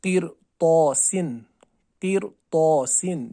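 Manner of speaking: किरतौसिन slowly with a heavy "tau"; heavy qaaf and taa. Shown as a demonstration. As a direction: recite किरतौसिन slow and thick. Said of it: "तौ अलिफ ज़बर तौ".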